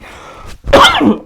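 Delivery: loud sudden cough; a real cough; sharp cough. Real, not acted. Coughs mid-sentence, excuse me.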